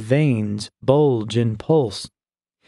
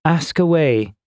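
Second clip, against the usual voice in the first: higher, theatrically resigned invitation; dramatic sigh; 5 semitones higher than usual. A narrator's reading. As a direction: give in with a touch of drama. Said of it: "she said with a dramatic sigh".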